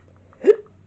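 sharp loud gasp. Real, not acted. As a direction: gasp suddenly. Sudden reaction mid story.

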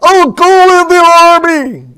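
loud, high outraged yell; general's cry. Acted. General threatening the soldiers, crying out. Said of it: "cried the general".

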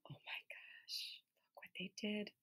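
breathless whisper of wonder; awed whisper; whispered amazement. Acted. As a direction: share a thrilling secret. Amazed secret to kids.

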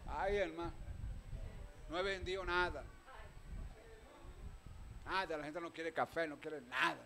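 soft, whiny complaint of a sad seller; mock tearful lament. Pastor voicing a glum church member who sold no coffee, softly whining. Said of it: "Lágrimas, llorando y la trompota".

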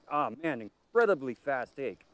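ordinary talk

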